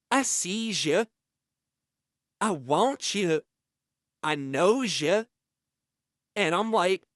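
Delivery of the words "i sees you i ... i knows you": lightly voiced predatory prisoner leaning on the bars; a hint of a menacing inmate, slowed down; imitating Fleece. Retelling the inmate's creepy advances.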